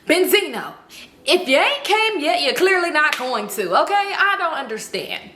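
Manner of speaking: high, emphatic exasperation; exasperated. Retold or imitated. Listener fed up with [name]'s noises, exasperated outburst.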